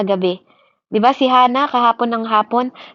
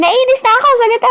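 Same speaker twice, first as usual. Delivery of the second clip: mock angry complaint; 12 semitones higher, 4 dB louder, faster than usual. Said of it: "Hindi naman siya sumisigaw doon eh".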